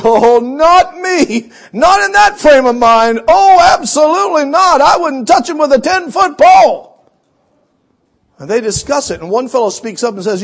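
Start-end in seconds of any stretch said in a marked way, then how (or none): 0.0-6.9 loud, rising panicky no; mock alarmed refusal. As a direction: back away from the angry leader. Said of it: "Another guy sort of backs away".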